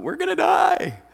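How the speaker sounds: high-pitched, loud panicked cry; mock panic. Disciples crying out in the storm, mock panic. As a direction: play terrified men in a hammed-up shriek. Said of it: "the disciples are freaking out".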